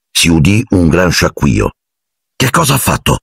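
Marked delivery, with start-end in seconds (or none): 2.3-3.2 higher, tense question. A narrator's reading. Harry to Ron, tense and worried. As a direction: ask it on edge. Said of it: "chiese Harry teso".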